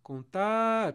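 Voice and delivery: loud, high call with "tar" held long. Shown as a demonstration. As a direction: call "Contar" like summoning someone far away. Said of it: "Percebe que eu tô chamando a palavra?".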